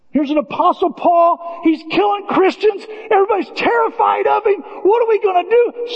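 climbing preacher's roar; full-force preaching. Acted. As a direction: preach it hard from the pulpit.

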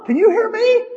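high, loud call as if from another room; voice from out the door. Acted. Anesthesiologist checking on a drifting patient, distant high call. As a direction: call out high and drawn, mimicking a distant doctor. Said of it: "It sounded like he was, like, out the door".

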